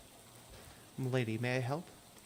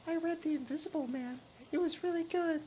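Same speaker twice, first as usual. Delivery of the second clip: put-on much higher, thin ghostly girl voice; hitchhiker Lydia; 14 semitones higher than usual. Hitchhiker asking the player for a ride, high ghostly voice. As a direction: play the strange girl in the rain begging a lift.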